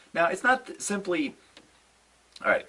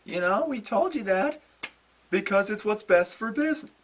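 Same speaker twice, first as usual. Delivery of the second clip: higher, put-on smug voice; mock Triple H and Stephanie gloating; 11 semitones higher than usual. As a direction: mimic their gloating. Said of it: "they're like".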